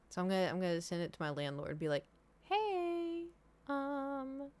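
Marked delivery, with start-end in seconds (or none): 2.4-4.5 high, drawn-out sing-song greeting; hesitant, polite opener. Pretend. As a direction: rehearse an awkward, careful approach. Rehearsing message to landlord.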